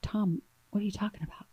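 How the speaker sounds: whispered disbelief, fast; stunned hushed whisper. Retold or imitated. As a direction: breathe the question in shock. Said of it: "And I was like".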